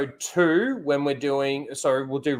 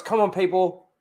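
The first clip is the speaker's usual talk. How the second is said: ordinary delivery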